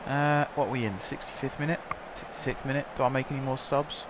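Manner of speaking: ordinary delivery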